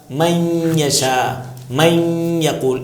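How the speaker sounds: من held as long nasal "maiyy" before یشا and یقول; nasal ghunna. Shown as a demonstration. Teacher giving examples, من stretched nasally into یشا, یقول. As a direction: slowly hum through من into the Y. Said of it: "یہ سب میں نون کی آواز آئے گی".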